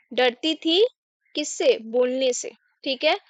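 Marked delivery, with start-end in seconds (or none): none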